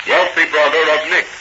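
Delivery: ordinary talk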